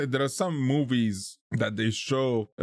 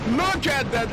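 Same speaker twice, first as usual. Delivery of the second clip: excited; 11 semitones higher, 4 dB louder than usual. Real, not acted. Amazed at fireworks.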